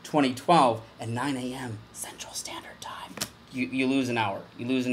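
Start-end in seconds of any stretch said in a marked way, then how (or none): 1.0-3.2 whisper. Acted. Mock solemn aside on time.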